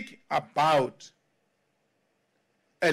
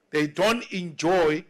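ordinary talk

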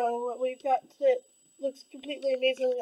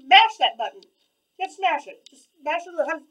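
loud hype; 7 dB louder than usual. Real, not acted. Hyping viewers to like video.

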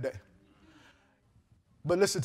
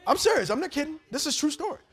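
high insistent; 9 semitones higher than usual. Real, not acted. Insisting story is true.

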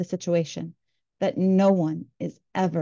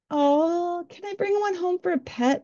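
put-on much higher, sweet pleading kid voice; cooing kids; 11 semitones higher than usual. Kids on the phone beg to keep a sea lion, cooing high. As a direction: coo it like a kid begging for a pet. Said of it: "they cooed about the sea lions".

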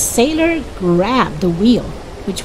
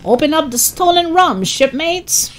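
shouting captain; 9 semitones higher than usual. A narrator's reading. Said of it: "the captain shouted".

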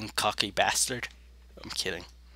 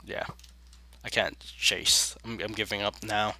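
ordinary talk, nothing marked

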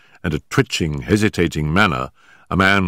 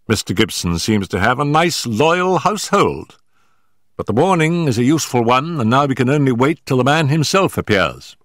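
Sherlock Holmes voice; 9 semitones higher, 3 dB louder than usual. Acted. Holmes wryly comments.

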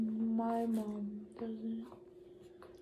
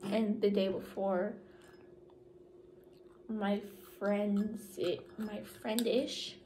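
talking around a straw; straw in mouth. Real, not acted. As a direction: lips gripping straw. Seen 0: Pink straw held in mouth corner while she talks.

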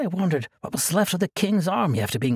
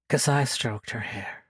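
ordinary delivery, nothing marked

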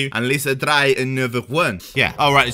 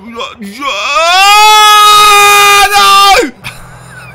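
a high, loud burst of laughter; loud laughing shriek; 11 dB louder than usual. Real, not acted.

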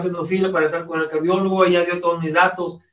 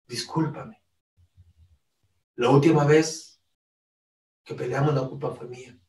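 tender apology; 3 semitones lower, 4 dB quieter than usual. Pretend. Spouse asking forgiveness.

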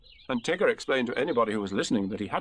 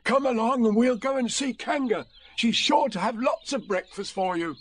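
higher, encouraging call; 11 semitones higher, 4 dB louder than usual. A narrator's reading. Bear calling the tiger to breakfast, high and encouraging. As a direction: call across to him, pitched up. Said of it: "called to Tigger".

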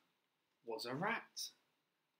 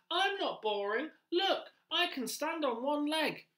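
much higher, louder squeaky rat; rat character; 14 semitones higher, 6 dB louder, slower than usual. Acted. Teacher voicing the pet-shop rat to children, high and loud. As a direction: play the pushy little rat, high and loud.

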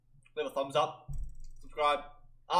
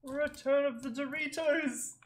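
announcer voice; 10 semitones higher, slower than usual. Acted. Announcing the video title.

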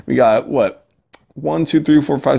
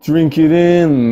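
slow, louder wrestler drawl; 3 semitones higher, 5 dB louder, much slower than usual. Pretend. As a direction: savor each word like a heel.

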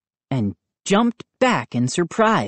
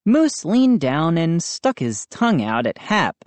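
ordinary delivery, nothing marked

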